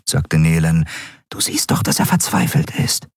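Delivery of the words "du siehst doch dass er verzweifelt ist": whispering. A narrator's reading. Nelen whispers to Endriel, urging her to take the boy. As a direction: lean in and whisper to a friend. Nelen urges friend quietly.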